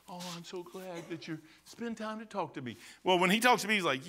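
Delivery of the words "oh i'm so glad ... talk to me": a soft, syrupy mock-gentle God voice; hushed, sugary imitation of a gentle Lord; mock sweet God. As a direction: parody a soft, doting God, much quieter. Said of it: "He probably talks".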